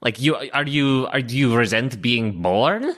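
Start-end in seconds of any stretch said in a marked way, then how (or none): none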